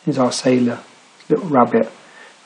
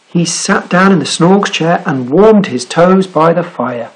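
ordinary delivery

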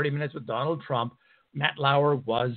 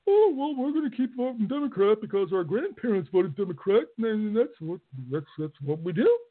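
mock hillbilly voice; 8 semitones higher than usual. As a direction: imitate a stubborn local voter, half-mocking. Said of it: "I don't really talk like that".

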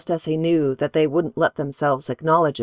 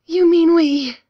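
put-on high, loud shocked girl's voice; aghast girl; 13 semitones higher, 6 dB louder than usual. A narrator's reading. A girl realizes the pastor's plan, high shocked outburst. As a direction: blurt it out in horror. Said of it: "face aghast".

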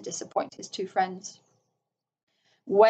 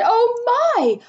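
high, squeaky little rabbit voice; tiny high-pitched creature marvelling; awestruck rabbits; 4 dB louder, slower than usual. A narrator's reading. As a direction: squeal it in wonder. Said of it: "rabbits held up their forepaws and said".

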